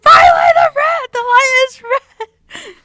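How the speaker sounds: high, loud panicked yell; panicked shout. Retold or imitated. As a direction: scream it like a panicked passenger. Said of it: "She's like".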